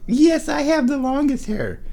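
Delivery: very high, cooing pet voice. Real, not acted. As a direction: coo it in a tiny high voice. Baby talk to the cat.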